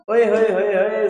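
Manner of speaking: loud, high mock cheering hoots; teasing hoots. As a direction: hoot like cheeky students. Mock students cheering proposal. (शरारती छात्रों की तरह hooting)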